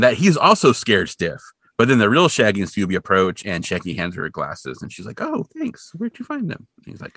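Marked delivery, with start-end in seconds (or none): 5.2-6.7 much higher, softer Velma voice; lightly voiced Velma. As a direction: voice the grateful Velma.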